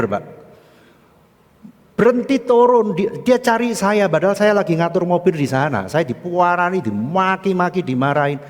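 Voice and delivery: high, loud, worked-up grievance; aggrieved complaint. Acted. Security guard telling the pastor of being scolded, aggrieved complaint. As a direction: pour out the injustice to the pastor.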